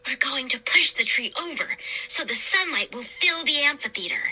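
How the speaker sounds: ordinary delivery, nothing marked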